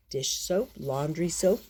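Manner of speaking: usual speaking voice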